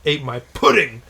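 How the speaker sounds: mock angry yell. Boyfriend discovering pudding eaten.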